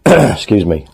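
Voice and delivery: ordinary talk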